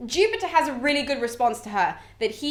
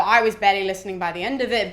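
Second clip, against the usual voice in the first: ordinary delivery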